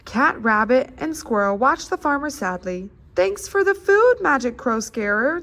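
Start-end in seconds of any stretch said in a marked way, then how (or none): 3.1-5.4 a higher, louder little cat voice; lightly voiced grateful cat; cat's voice. A narrator's reading. Cat thanking the scarecrow for food, high and bright-voiced. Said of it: "the cat said".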